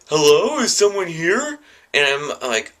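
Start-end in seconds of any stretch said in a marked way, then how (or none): 0.1-1.6 high, calling out "Hello, is someone here?"; calling woman. Retold or imitated. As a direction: call out into the empty house, unsure. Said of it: "they're like".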